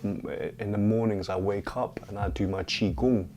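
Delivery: normal talking voice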